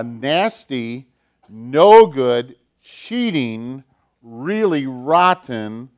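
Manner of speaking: slow, loud, every insult punched; emphatic list. Acted. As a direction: drag out each word of the list to hammer it in. Child sermon, villain described emphatically.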